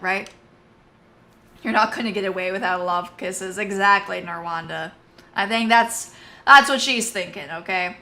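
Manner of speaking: normal talking voice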